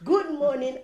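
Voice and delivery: "Good morning" sung out high and loud; loud cheery greeting. Retold or imitated. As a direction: greet her warmly and loudly, high up. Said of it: "And they said".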